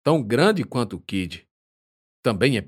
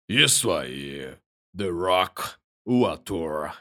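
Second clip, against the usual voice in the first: low, slow big man voice; deep chesty voice; 3 semitones lower, much slower than usual. Acted. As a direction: answer proudly, deep and slow. The Rock answers, voiced.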